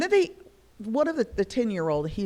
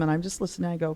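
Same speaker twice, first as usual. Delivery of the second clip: ordinary talk, nothing marked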